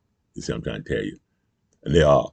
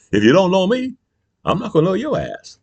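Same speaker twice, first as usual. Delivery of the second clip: high, loud mock Jesus voice; quoting Jesus; 4 dB louder than usual. Vlogger voices Jesus rejecting voters, high and loud. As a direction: deliver it as Jesus would. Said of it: "And he said".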